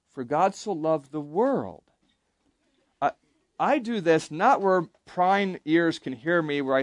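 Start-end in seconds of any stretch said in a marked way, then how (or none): 0.1-1.8 "world" peaks and falls at the end; stress on world. Shown as a demonstration. Teacher demonstrating emphasis in a verse, stress on "world". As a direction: save the emphasis for "world", slowly. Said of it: "emphasize different words".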